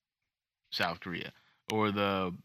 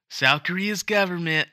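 Trump voice; 8 semitones higher, 4 dB louder than usual. Pretend. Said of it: "no, I'm kidding".

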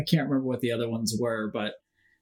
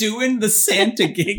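mocking echo; 7 semitones higher, 4 dB louder, slower than usual. Real, not acted.

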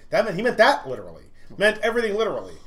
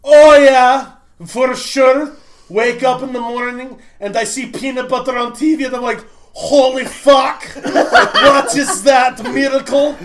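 put-on loud, high, slow Russian-accented rant; Russian roommate's rant; 7 semitones higher, 9 dB louder, slower than usual. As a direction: rant in an exaggerated Russian accent, dragging the words out. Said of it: "And he goes".

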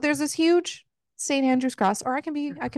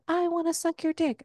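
higher, oddly sweet voiced line; a strange little breathy-free voicing pitched up; odd put-on voice; 7 semitones higher than usual. Host quoting the heroine in an odd pitched-up voice. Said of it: "I don't know why I said it like that".

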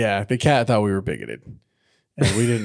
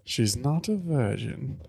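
movie quote; 4 semitones higher, 6 dB quieter, slower than usual. Retold or imitated. Ace Ventura quote.

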